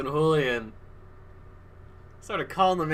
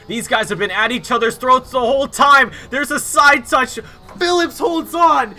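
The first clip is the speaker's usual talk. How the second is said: screaming play-by-play, much higher and louder; excited commentary; 6 semitones higher, 4 dB louder than usual. Real, not acted. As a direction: call the last lap at fever pitch. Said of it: "I got too excited".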